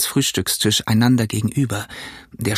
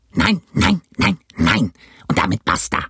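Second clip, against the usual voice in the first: rough captain's outburst, pitch leaping; angry cry; 3 semitones lower than usual. Acted. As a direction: refuse furiously in a rough character voice. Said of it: "schrie er einmal". (wütender, rauer Aufschrei des Kapitäns)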